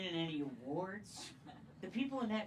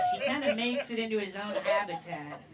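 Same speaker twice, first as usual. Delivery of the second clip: ordinary delivery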